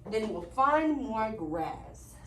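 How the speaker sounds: slow, low big goat voice; big billy goat. Acted. Teacher voicing the big goat to the class, slow and low. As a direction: rumble it calmly like the eldest goat.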